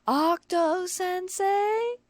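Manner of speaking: high, drawn-out shrill yell; angry call. A narrator's reading. The stalker calling for her author, angry and loud. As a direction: bellow the name slowly up the stairs. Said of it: "voice ringing out angrily".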